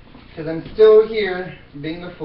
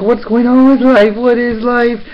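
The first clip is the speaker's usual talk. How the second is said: louder, higher despairing cry; exasperated cry; 5 semitones higher, 9 dB louder than usual. Retold or imitated. Crying out in despair after the fire alarm falls down. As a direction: throw up your hands at life. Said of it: "And I was like".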